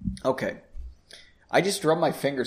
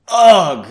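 struck grunt; 4 semitones higher, 12 dB louder than usual. Acted. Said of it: "heavy wooden bookend swung into his temple".

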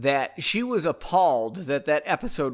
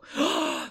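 mock gasp. Mock gasp before spoiler.

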